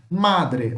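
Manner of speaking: MADRE with a long, strong first syllable; stress on MA. Shown as a demonstration. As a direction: lean slowly into the MA of madre. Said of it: "o ma é mais forte".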